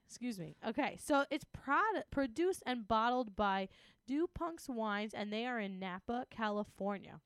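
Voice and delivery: ordinary talk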